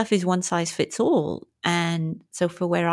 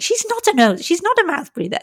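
kind friend defending; 12 semitones higher, 3 dB louder than usual. Retold or imitated. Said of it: "Mary Beth very kindly was like".

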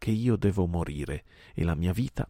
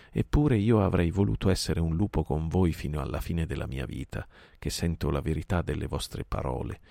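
usual speaking voice

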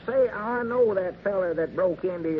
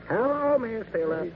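phone greeting; 7 semitones higher than usual. Acted. Abner greets caller on phone.

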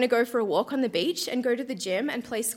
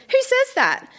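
indignant; 11 semitones higher than usual. Acted. Indignant diary outburst read aloud.

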